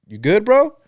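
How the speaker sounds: lightly acted, check on your buddy; casual check-in. Friend checking if his buddy is good, casual check-in.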